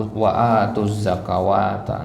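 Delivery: wa atuz ZAKAWATA with a long "waa"; wrong reading ZAKAWATA. Shown as a demonstration. Teacher warns against this reading, ZAKAWATA with waw.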